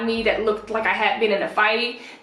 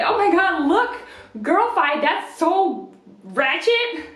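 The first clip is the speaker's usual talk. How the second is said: higher-pitched gossipy disbelief; high, drawn-out mock disgust; mock scandalized; 6 semitones higher, slower than usual. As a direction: imitate a scandalized onlooker, laugh in the voice. Acts out gossiping friend.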